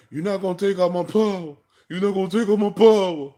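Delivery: high, loud mock wail; crying little kid. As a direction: whine it like a kid crying mad. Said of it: "you're a little kid ... and you're crying".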